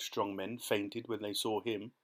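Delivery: plain reading voice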